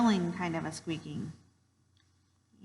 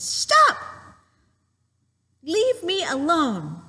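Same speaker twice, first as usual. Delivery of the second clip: high yell, then slow loud plea; acted protest; 8 semitones higher, 7 dB louder, much slower than usual. Child picked on by an older sibling, acted loud protest. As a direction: shout high, then stretch the words out firmly. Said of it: "being picked on by an older brother".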